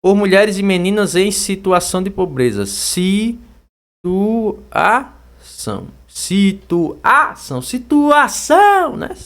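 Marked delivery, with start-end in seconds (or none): 2.7-7.6 slow "Situação", each syllable isolated with pauses. Shown as a demonstration. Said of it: "Tá aí a sílaba tônica". 7.6-9.0 high, loud "Situação" stretched on ÇÃO; calling-out demo. Shown as a demonstration. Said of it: "O são".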